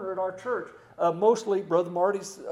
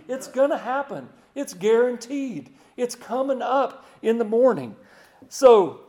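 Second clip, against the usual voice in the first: higher and louder, every phrase hammered home; preaching at full force; 5 semitones higher, 10 dB louder than usual. Real, not acted. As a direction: ramp up like a revival preacher at his peak. Preacher rising to a climax.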